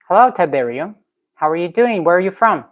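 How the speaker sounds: usual speaking voice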